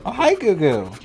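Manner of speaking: high loud squeaky coo. Real, not acted. Baby talk to dog.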